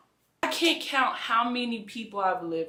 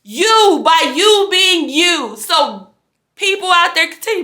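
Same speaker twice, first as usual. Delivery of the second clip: much higher, louder shout of encouragement; rally the crowd, full voice; rousing encouragement; 12 semitones higher, 15 dB louder than usual. Real, not acted. Woman loudly urging followers to stay themselves.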